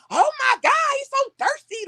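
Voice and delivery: high-pitched mocking disdain; full-blown attitude. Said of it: "she had an attitude ... like a full blown".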